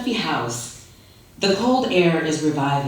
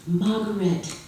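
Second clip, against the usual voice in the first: higher, delighted Margaret; lit up; 5 semitones higher, 4 dB quieter than usual. A narrator's reading. Father greeting his visiting daughter, lit-up Margaret. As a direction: call Margaret the way a lonely father brightens. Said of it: "who lights up at your visits".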